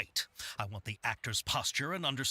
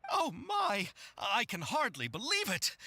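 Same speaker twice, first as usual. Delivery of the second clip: high-pitched, thrilled exclamation; overjoyed disbelief; 7 semitones higher than usual. Acted. Director to new crew member who just agreed, thrilled. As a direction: let the pitch leap with delighted amazement.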